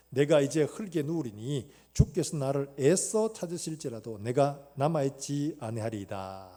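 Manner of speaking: slow, loud solemn reading of Job; scripture reading. Acted. Pastor reading Job to the congregation, slow and solemn. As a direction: declaim the verses slowly.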